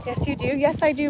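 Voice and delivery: normal talking voice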